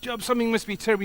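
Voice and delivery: high, rapid-fire accusing quote; acted accusation. Friends pressing suffering Job, urgent acted accusation. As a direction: press the charge quickly, pitched high.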